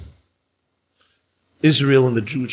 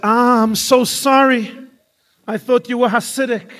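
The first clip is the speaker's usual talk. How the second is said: effusive apology; 11 semitones higher, 5 dB louder than usual. Acted. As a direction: gush an apology at a much higher pitch. Acted embarrassed apology by the woman.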